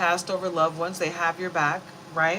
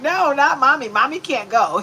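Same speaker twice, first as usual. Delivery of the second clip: higher, louder dog voice insisting; puppy protest voice; 8 semitones higher, 10 dB louder than usual. Retold or imitated. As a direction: protest like a possessive pup. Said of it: "They're like".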